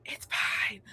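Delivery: breathy whispered "It's fine"; whispered aside. Real, not acted. She whispers a wry aside to her friends, whispered.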